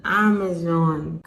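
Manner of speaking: ordinary delivery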